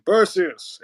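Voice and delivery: drawn-out character voice for Perseus; original film Perseus. Shown as a demonstration. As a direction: say the name slowly, in character. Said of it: "for the voices I was talking about".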